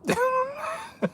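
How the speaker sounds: laughing. Real, not acted. Laughing, voice cracking.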